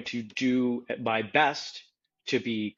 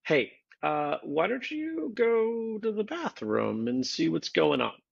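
gentle teacher voice; 4 semitones higher, slower than usual. Retold or imitated. Teacher gently redirects a student.

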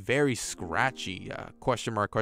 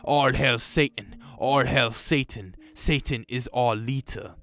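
low, slow demonic chant; slow, deeper hellish incantation, lightly voiced; deep distorted voice; 3 semitones lower than usual. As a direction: intone it like a possessed cult leader. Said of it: "in a loud, deep, distorted voice".

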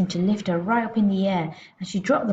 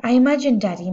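ordinary delivery, nothing marked

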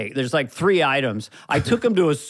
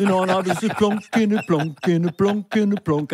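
higher lilting Liverpool musician; 4 semitones higher than usual. Acted. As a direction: bounce through it like a modest Scouse bassist.

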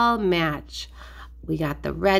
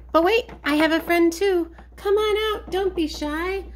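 much higher, encouraging toy voice; coaxing puppet character; 8 semitones higher than usual. Pretend. Duck invites a shy friend out, high coaxing voice. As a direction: coax a friend out, pitched high.

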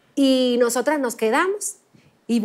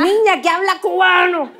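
retold producer's order; 4 semitones higher, 4 dB louder than usual. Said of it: "the producer's demand".

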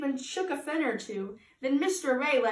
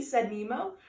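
plain reading voice

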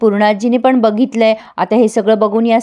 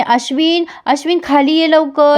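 mock calling out; 6 semitones higher than usual. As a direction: imitate calling down from afar, pitched up. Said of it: "ती अश्विनला आवाज देते मोठ्या मोठ्याने". (अश्विनला वरून हाक मारल्यासारखा उंच आवाज)

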